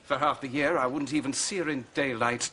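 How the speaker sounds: usual speaking voice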